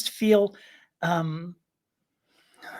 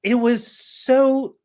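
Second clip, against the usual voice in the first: stammering. Real, not acted. Flustered speaker trying to describe it, stammering. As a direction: stumble over the words, flustered and apologetic. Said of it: "now I'm stammering".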